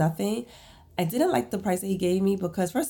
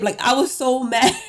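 louder, higher mad laugh; laughing while fuming; 4 semitones higher, 5 dB louder than usual. Real, not acted. Vlogger to viewers, mad and laughing.

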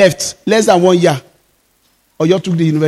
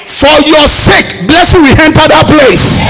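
preaching at full force; 6 semitones higher than usual. Acted. Pastor to his congregation, preaching at full force. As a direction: lift the congregation, ringing out each line.

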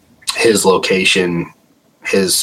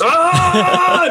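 very high device shriek; imitated shriek. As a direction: copy the meter going off. Meter shrieking again.

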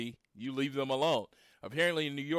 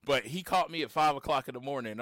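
ordinary talk, nothing marked

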